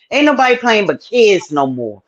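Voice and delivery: normal talking voice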